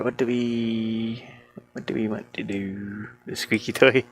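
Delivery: ordinary delivery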